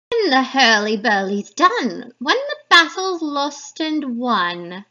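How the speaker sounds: loud witch voice, slowing at the end; eerie loud crone intoning; second witch. Acted. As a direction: chant it like a gleeful hag. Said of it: "introduce you to the three witches".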